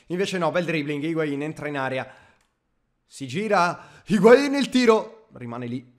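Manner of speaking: rising, shouted football commentary; live play-by-play. Acted. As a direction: call the attack like a TV commentator. Commentary on attack.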